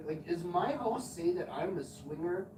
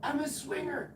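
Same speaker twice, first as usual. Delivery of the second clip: high neighbor woman voice; 10 semitones higher than usual. Retold or imitated. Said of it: "And she goes".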